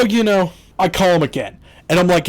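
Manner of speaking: ordinary talk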